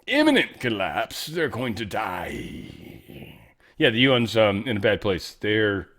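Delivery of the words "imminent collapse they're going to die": long drawn-out, trailing wail of doom; mock doom. Host exaggerating the U N's collapse, drawn-out mock doom. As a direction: stretch it out as a melodramatic death knell.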